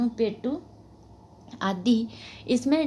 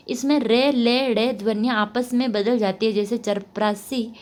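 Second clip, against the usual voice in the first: normal talking voice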